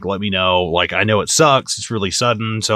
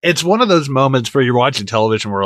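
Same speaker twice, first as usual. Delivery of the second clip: ordinary delivery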